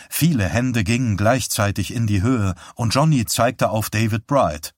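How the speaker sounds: ordinary delivery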